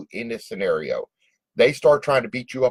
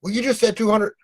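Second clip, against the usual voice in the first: high-pitched mock-indignant customer; acted customer protest; 12 semitones higher than usual. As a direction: protest in a much higher voice, as a baffled customer. Acted customer protests doubled-back price.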